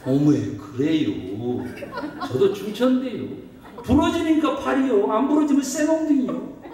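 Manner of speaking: drawn-out, then high Chungcheong dialect; joking in a put-on Chungcheong accent. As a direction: play up a Chungcheong regional accent for laughs. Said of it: "충청도라".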